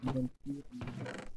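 ordinary delivery

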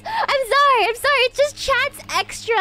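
high, loud, giddy apologizing; worked-up sorry after a laughing fit; flustered apology. Real, not acted.

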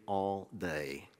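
a slow breathy whisper, no voice at all; whispered scripture. Acted. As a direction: hush it out slowly, like a secret. Reading Ephesians, drops to whisper.

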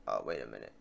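usual speaking voice

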